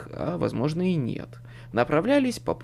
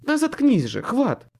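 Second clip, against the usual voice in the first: raised, high-pitched female command; exasperated woman barking; barked order; 10 semitones higher, 5 dB louder than usual. A narrator's reading. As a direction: snap at him to shut up. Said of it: "рявкнул женский голос".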